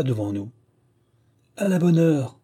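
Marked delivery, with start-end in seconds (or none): 1.6-2.4 a cheery exclamation, higher and a bit louder; exclaiming. A narrator's reading. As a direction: call it out with gusto. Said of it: "s'écria le sergent". (le sergent s'écrie, ravi)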